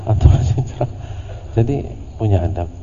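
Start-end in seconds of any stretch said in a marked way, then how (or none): 0.1-1.0 muttered, breathy aside; mock aside whisper. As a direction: grumble it under your breath. (bisikan menggerutu)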